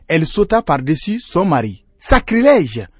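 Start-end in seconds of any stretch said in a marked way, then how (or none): 2.0-2.9 high outraged exclamation; scandalised cry. A narrator's reading. Hyena denouncing the sheep's leap, scandalised cry. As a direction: cry it out in mock horror. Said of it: "s'écria l'hyène".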